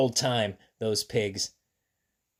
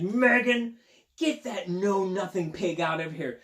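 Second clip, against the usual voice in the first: scolding teacher; 4 semitones higher than usual. Acted. Teacher scolds girl in story.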